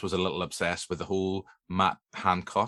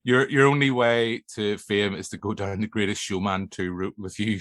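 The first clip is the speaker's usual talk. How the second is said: ordinary talk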